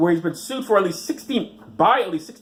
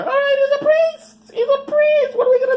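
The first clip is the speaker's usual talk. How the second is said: squeaky high mock believers, louder; gullible parishioners; 6 dB louder than usual. Mocking churchgoers who trust any priest, high squeaky voice. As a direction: play dumb, trusting churchgoers in a falsetto.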